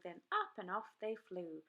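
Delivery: usual speaking voice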